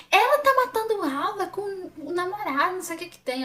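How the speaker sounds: voz aguda, alta e arrastada de acusação; imitando mulher brigando. Retold or imitated. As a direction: imite uma acusadora em tom agudo e esticado. Mulher briga com a mãe. (imitando bronca com voz aguda)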